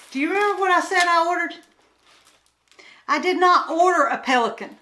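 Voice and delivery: real exasperated near-yell. Real, not acted. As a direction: protest loudly at the wrong order. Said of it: "I'm sorry, I'm yelling".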